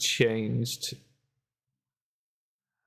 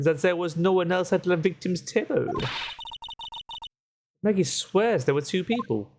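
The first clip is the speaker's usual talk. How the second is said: lightly put-on French accent, café owner voice; 4 semitones higher than usual. Café owner telling the lawyer what happened, light French accent. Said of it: "I hate doing the accent".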